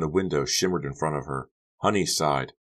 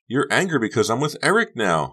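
high, loud, cutting in; interrupting; 8 semitones higher, 5 dB louder than usual. A narrator's reading. Honey interrupting Becca about Eric, insistent. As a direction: break in with your own theory. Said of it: "Honey interrupted".

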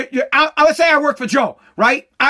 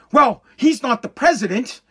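usual speaking voice

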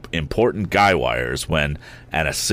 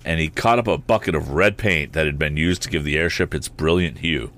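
usual speaking voice

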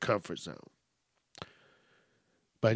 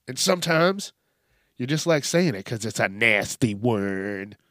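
ordinary talk, nothing marked

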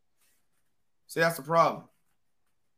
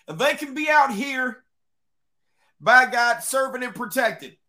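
country voice; 6 semitones higher, 4 dB louder than usual. Acted. Proudly pitching veterans as school guards, in a country voice. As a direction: proclaim it in a put-on country accent. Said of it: "I gotta put my country voice on".

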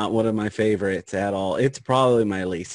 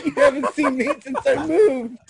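higher, laughing whiny imitation; mock sad whine; 3 dB louder than usual. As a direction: imitate him whining, laughing. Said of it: "Mark said".

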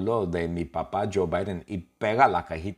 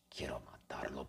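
whisper; 6 semitones lower, 18 dB quieter than usual. Acted. Secret threat aside.

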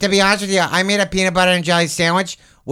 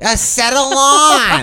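game show host; 8 semitones higher, slower than usual. Pretend. Game show announcer bit.

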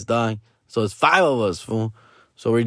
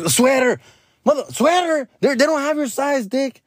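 dad hears his son wants a sweater, high incredulous; 12 semitones higher than usual. Retold or imitated. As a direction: balk at the sweater idea.

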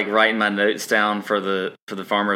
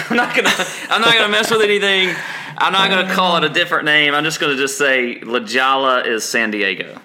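higher, louder and fast; exasperated inner voice; 7 semitones higher, 4 dB louder than usual. Retold or imitated. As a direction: rattle it off in a fluster. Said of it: "I was like".

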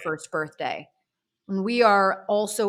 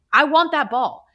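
straightforward, higher and louder claim; frank admission; 6 semitones higher, 5 dB louder than usual. Retold or imitated. Telling the star to just say it, frank admission. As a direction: own up to it firmly, in your own voice. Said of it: "Just say it. Just be like".

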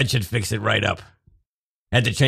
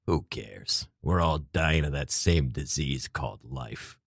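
low, slow world-weary sigh; weary resignation; 7 semitones lower, 7 dB quieter, slower than usual. Acted. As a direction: drag it out like a tired cynic. Frank's weary philosophizing.

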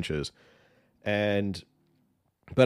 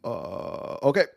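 higher halting speechy voice with uh pauses; mock Obama's stop-start cadence; Obama impression; 5 semitones higher, slower than usual. Host mocking Obama's habit of saying uh, high halting impression. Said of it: "He always said".

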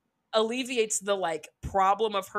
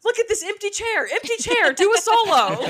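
high, loud mocking yell at an empty chair; mock shrill outburst; 11 semitones higher, 9 dB louder than usual. Mom mocking the dance teacher in the green room, high mock yell. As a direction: shout it like a mom losing it, hammed-up. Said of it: "And she goes".

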